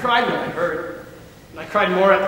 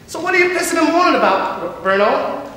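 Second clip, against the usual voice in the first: mocking jab; 10 semitones higher, 6 dB louder than usual. Gordy mocking Teddy.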